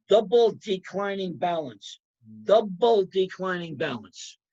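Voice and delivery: "Double" punched at the start, "declining balance" lighter; teacher hammering "Double" home; stress on double. Shown as a demonstration. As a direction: lean hard on "Double" each time. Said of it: "I'm emphasizing the word double".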